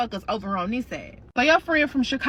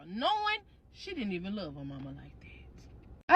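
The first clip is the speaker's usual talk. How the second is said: hushed side comment, no voice at all; catty whisper; 4 semitones lower, 11 dB quieter than usual. Real, not acted. Narrator whispering a dig about mama's fake grief. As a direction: whisper the dig so only she hears.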